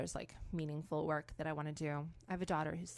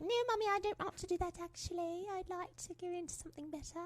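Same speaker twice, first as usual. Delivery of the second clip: put-on high posh little girl voice; daughter's voice; 12 semitones higher, 3 dB quieter than usual. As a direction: play the prim English daughter, pitched up. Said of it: "She'll rebel, be like".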